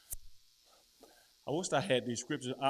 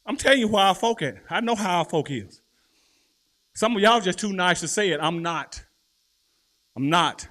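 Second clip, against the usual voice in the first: loud, high, emphatic sermon voice; full-force preaching; 4 semitones higher, 10 dB louder than usual. Acted. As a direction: drive the point home.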